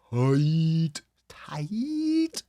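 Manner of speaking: comically strained, elongated TIGHT; drawn-out TIGHT. Shown as a demonstration. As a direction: stretch TIGHT out long, jumping high. Drawing out tight for comic emphasis.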